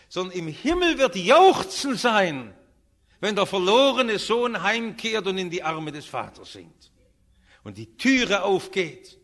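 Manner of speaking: loud, high-pitched proclamation; exultant preaching. Real, not acted. Preacher telling of the father welcoming his lost son, exultant. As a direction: ring out the rejoicing to a whole congregation. Preaches heavenly rejoicing at full force.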